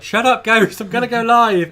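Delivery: loud, high yell at a barking dog; shouting at the dog before going live; retold shout. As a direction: bark it across the house. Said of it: "I was like".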